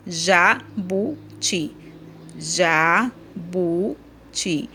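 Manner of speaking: very slow, each syllable split apart. Shown as a demonstration. Teacher splitting the word into syllables for class, syllable by syllable. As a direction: break JA, BU, TI into separate beats with pauses. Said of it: "você vai separando as sílabas".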